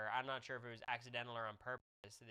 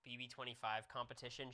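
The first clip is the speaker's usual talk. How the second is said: normal talking voice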